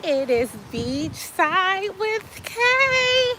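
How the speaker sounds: high, slow sing-song show title; show intro. Acted. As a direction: stretch out the show name like a jingle. Sung-out show title call.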